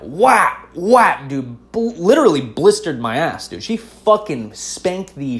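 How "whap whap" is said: sharp, higher, louder whap noises; spank sound. Retold or imitated. Mom spanking him in the mall, imitated slaps. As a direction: smack out each spank with your voice. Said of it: "And she went".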